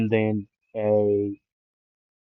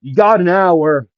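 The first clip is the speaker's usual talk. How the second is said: louder, higher, slow holler; yelled announcement; 6 semitones higher, 7 dB louder than usual. Acted. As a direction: call the deadline out to everyone. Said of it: "Aizawa would yell".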